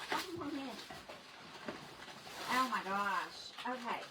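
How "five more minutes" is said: soft, coaxing friend voice; quiet mock pleading. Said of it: "she kept telling me to stay".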